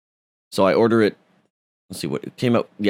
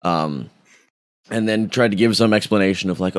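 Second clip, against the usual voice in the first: usual speaking voice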